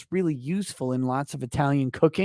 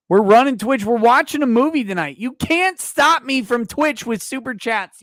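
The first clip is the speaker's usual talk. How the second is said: higher and louder, daring the chat; defiant loud rant; 8 semitones higher, 7 dB louder than usual. Real, not acted. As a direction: shout them down, refusing to back off.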